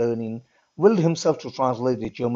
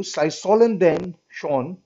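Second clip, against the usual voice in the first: slow, careful reading of the German words; slower than usual. Shown as a demonstration. Teacher reading the German note to the class, slow and careful. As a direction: sound out each German word slowly. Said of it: "I'll try my best to read the German text".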